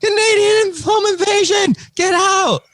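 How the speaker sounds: shrieked high yell, laughing through it; high-pitched mock shout of an outraged husband. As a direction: scream it in mock panic at the intruders. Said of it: "Captain B's like".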